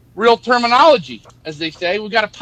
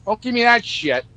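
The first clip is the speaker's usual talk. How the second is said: ordinary delivery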